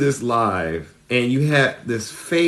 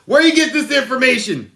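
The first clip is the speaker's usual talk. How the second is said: mock interrogation; 11 semitones higher, 3 dB louder than usual. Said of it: "He goes".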